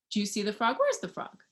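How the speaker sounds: ordinary delivery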